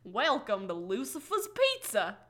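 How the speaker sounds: put-on slow recorded-greeting parody in an accent; Dax Shepard. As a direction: imitate a comic pizza phone menu, drawn out. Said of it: "Dax Shepard does this one impression".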